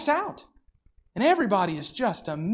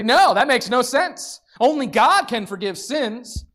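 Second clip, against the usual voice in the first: sharp dismissive retort, a little louder; scoffing objection; 5 semitones higher, 5 dB louder than usual. Retold or imitated. Teachers of the law scoffing at Jesus forgiving sins, sharp and dismissive. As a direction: scoff at the idea, pushing back. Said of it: "And they say".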